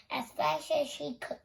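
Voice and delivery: plain reading voice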